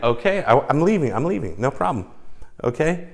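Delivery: high, loud, hands-off surrender; retold placating retreat. Date to screaming woman as they go, placating retreat. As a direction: act out backing off from a screamer.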